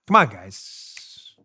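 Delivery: slow, drawn-out mock impatience; sarcastic jerk. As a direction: drag out a sarcastic complaint. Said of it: "a sarcastic jerk for effect".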